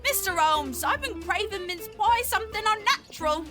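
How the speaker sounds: very high, loud overwrought client; distressed appeal. Acted. Client tells Holmes of unnatural cravings, high loud distressed appeal. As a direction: wail your complaint to the detective.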